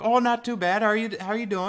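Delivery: high, loud Canadian border guard; hammed-up cheery Canadian accent. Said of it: "have to have the right voice".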